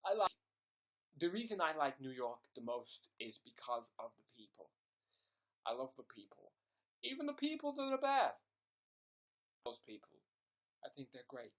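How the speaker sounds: low-pitched put-on New Yorker; New York accent. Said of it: "Americans have New York".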